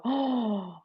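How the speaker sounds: a soft, quiet surprised "Oh"; little gasp. Shown as a demonstration. Teacher demonstrates the inhale, soft surprised gasp. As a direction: catch your breath on a small "Oh". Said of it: "the little, "Oh my," gasp".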